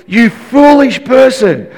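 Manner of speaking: mock name-calling, high and drawn out; [name]. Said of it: "now he's name calling".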